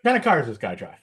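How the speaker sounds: a lightly voiced, smug Reynolds; Burt Reynolds impression. Norm's Reynolds stalling, asking about the guy's car. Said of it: "Reynolds just goes".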